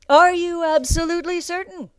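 high, loud demand; drill master's challenge. A narrator's reading. Drill master testing recruit, barked loudly. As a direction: bark the question in his face.

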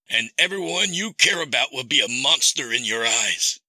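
high, controlled villain voice; menacing captor. Acted. Captor gives Sam a cruel ultimatum, menacing. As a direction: pronounce the threat as the captor. Kidnapper's voice in narrated story.

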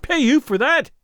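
crying out. A narrator's reading. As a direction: throw it back at him, outraged. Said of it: "he cried".

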